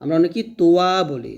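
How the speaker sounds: ordinary delivery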